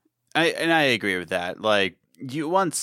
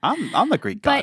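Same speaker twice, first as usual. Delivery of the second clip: playful boast; 13 semitones higher, 3 dB louder than usual. Pretend. Jokingly claims to be Greek god.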